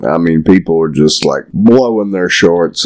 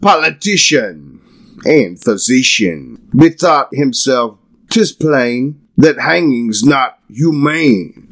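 put-on higher, declaiming old verse grandly; theatrical poem reading; 6 semitones higher than usual. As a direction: recite it like a pompous bard. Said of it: "reading old poem theatrically".

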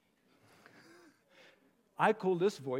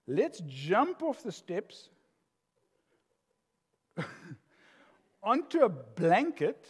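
put-on excited kid suggestion; slower than usual. Voicing grandchild's suggestion.

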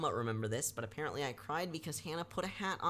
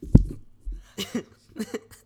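laugh; 7 semitones higher, 11 dB louder than usual. Real, not acted. Laughs at own joke.

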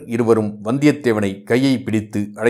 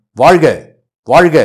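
loud rising hail, higher and louder; cheering shout; 5 semitones higher, 8 dB louder than usual. A narrator's reading. Soldiers hail the prince, a loud rising cheer. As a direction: call out in triumphant acclaim.

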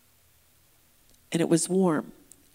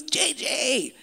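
high, delighted cry of a name; acted joyful greeting; happy recognition; 6 semitones higher than usual. Inmate recognizing his old teacher, overjoyed. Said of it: "He was so happy".

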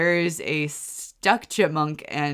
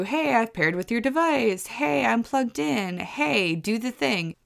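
higher, perky gadget voice; device voice; 6 semitones higher than usual. Retold or imitated. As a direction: chirp it like a cheerful smart speaker. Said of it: "supposed to talk and tell you".

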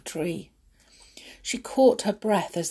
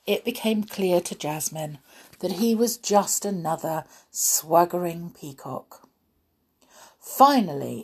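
usual speaking voice

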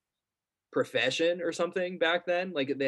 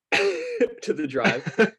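laughing; 9 semitones higher, 4 dB louder, much slower than usual. Real, not acted. Laughing through a joke.

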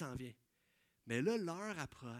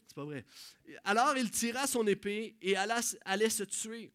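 normal talking voice

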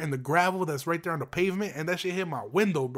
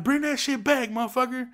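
high-pitched retold holler; calling after someone; 8 semitones higher than usual. Property manager ordering fleeing kid back, retold holler. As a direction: holler it down the block, without full force.

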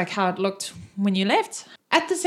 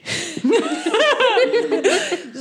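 high giggle; 14 semitones higher, 3 dB louder than usual. Acted. GM laughs in character.

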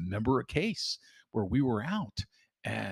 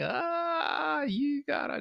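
high mock whine; much slower than usual. Mocking the late employee.

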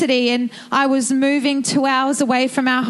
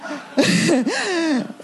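bursting laugh, higher; real laugh; 4 semitones higher than usual. Real, not acted. Laughing at own dad story.

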